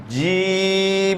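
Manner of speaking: জিম stretched long and even, very slow; long sustained জিম; drawn-out jim. Shown as a demonstration. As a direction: hold the vowel of জিম out long. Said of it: "এটা একটু টানি ভরতে হবে".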